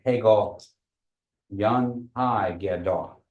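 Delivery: "Yan Hai Gadol" said slowly and evenly, each syllable drawn out; teacher's slow demo; slow Kiowa phrase. Shown as a demonstration. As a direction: model the Kiowa question slowly for learners. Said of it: "lengthen that last part".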